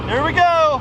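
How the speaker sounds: high, eager "here we go"; excited call-out. Real, not acted. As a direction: call it out high before the shot. Calls it out before firing.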